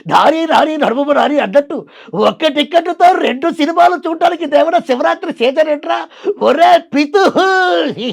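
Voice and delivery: high, giggly comic film voice with drawn-out yeahs; Sudhakar impression. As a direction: squeal it out high and loud, giggling between words. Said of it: "అరే, సుధాకర్ అనుకుంటా".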